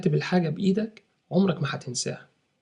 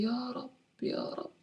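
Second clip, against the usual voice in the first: much higher, much softer, slow hushed plea; hushed pleading prayer; 8 semitones higher, 11 dB quieter than usual. Shown as a demonstration. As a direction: breathe it out like a desperate whispered prayer. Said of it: "وأنت بتدعي". (رجاء هامس بطيء)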